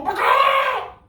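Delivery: very high, loud "ba-kaa". Shown as a demonstration. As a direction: squawk like a chicken at full blast. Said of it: "you can be louder".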